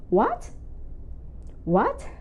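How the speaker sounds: "What?" shooting up high as a question; rising asking tone. Shown as a demonstration. Teacher acting asking someone, high rising tone. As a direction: flip "What?" sharply upward, asking. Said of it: "want to ask someone".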